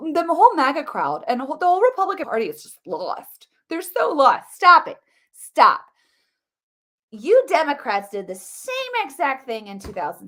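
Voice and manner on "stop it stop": exasperated stop. Real, not acted. Fed-up rant at critics.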